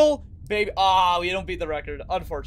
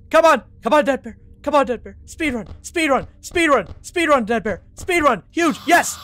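hyped, higher and louder; excited cheering; 6 semitones higher, 5 dB louder than usual. Real, not acted. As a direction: pump yourself up for the speedrun.

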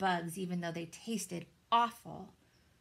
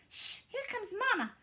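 hissed; 14 semitones higher, 5 dB louder than usual. A narrator's reading. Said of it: "hissed Pip".

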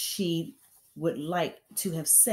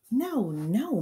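higher, pleading protest; cried out; 7 semitones higher, 5 dB louder than usual. A narrator's reading. Teacher protesting to the headmistress, crying out. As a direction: cry out in protest. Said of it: "Miss Honey cried out".

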